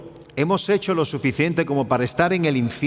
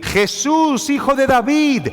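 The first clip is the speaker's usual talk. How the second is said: crying out; 7 semitones higher than usual. Retold or imitated. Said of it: "comenzó a dar voces".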